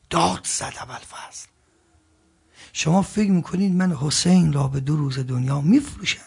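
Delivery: ordinary talk, nothing marked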